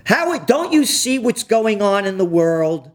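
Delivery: ordinary delivery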